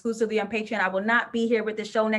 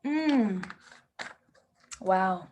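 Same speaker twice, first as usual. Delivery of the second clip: normal talking voice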